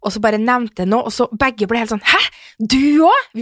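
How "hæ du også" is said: higher, louder disbelieving Du også; surprised. Retold or imitated. Friends realising they share the same issue, astonished. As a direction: gasp it out in astonishment.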